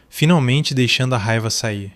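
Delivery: usual speaking voice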